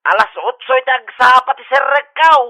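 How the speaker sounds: loud, booming megaphone call; megaphone announcement. Acted. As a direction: broadcast it down the street. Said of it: "Nagpigsamot din ti megaphone na". (napigsa nga ipakaammo iti megaphone)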